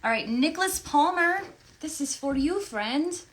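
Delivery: a sudden playful put-on voice; odd put-on voice. Signing a print for Nicholas, playful put-on voice. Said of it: "I don't know why I'm talking like this".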